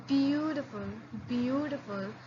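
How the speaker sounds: beautiful with a soft palatal b. Shown as a demonstration. Teacher demonstrates the soft b. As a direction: say beautiful softly and gently. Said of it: "it's little soft".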